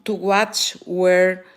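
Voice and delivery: ordinary talk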